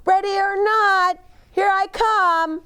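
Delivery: high, loud sing-song call. Acted. Boy calling to hidden Bear in hide and seek, high loud call. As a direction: shout it across the garden like a seeker.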